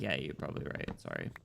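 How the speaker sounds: low, sheepish mock apology; apologetic. Streamer conceding to imagined critic, low mock apology. Acts own meek apology.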